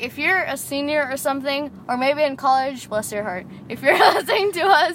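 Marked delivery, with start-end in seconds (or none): 2.9-4.9 laughing mock pity. Real, not acted. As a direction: coo it through laughter.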